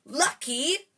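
high, loud outraged Lucky?; shriek. A narrator's reading. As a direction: shriek the word back at him. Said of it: "shrieked Hermione".

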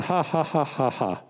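spoken-out fake laughter, quick and dry; forced laugh. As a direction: imitate laughing by sheer will. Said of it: "I just started laughing".

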